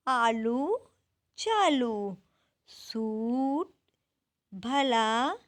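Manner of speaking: each word spaced out and read clearly like a teacher; rhyming words read aloud. Shown as a demonstration. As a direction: read the rhymes slowly one by one to the class. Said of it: "हमें पहले इनको अच्छे प्रकार से पढ़ना है". (कक्षा को तुकांत शब्द धीरे-धीरे स्पष्ट पढ़कर सुनाना)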